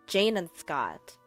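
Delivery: usual speaking voice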